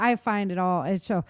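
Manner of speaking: usual speaking voice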